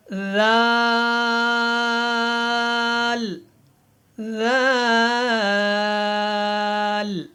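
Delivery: ذال stretched slowly and gently; soft, long zaal. Shown as a demonstration. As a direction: say ذال softly, tongue between the teeth. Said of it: "یہ دونوں نرمی کے ساتھ ادا ہوتے ہیں". (ذال کو نرمی سے لمبا ادا کریں)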